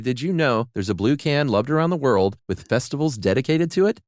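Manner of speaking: upbeat commercial announcer voice; ad read. Acted. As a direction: sell it like a cheery radio ad. Host reads Spam ad.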